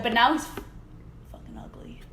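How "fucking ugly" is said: a hushed whisper under her breath; whispered insult. Real, not acted. Friend whispering a rude verdict on a singer's looks, hushed. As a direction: drop to a whisper for the rude bit.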